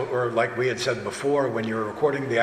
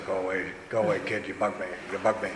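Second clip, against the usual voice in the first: low, softly muttered brush-off; lightly imitated comic grouch; W C Fields; 5 semitones lower, 6 dB quieter, faster than usual. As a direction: shoo a pest away in a low grumble. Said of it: "it's W C Fields".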